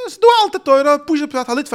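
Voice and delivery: very high, loud protest; high-pitched argumentative outburst; exclaimed objection. Acted. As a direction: shoot up high and loud, then ease down. Voicing an objection to his point.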